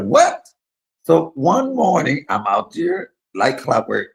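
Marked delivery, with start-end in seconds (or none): 0.0-0.5 sharp high startled what; a mother hearing her son is scared; shrill-free sudden high surprise; mother's shocked what. Retold or imitated. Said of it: "Mama was like".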